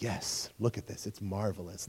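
low, slow, soft self-satisfied purr; mock a vain ruler savoring his own image, low and unhurried; cocky self-admiration. Nero admiring his own giant statue, mock cocky. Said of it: "Talk about cocky".

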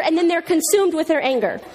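normal talking voice

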